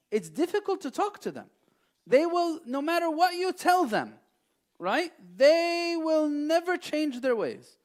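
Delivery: high, loud, slowing at the end; impassioned preaching. Real, not acted. Preacher insists to the audience, loud and high. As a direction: drive the point home with rising force.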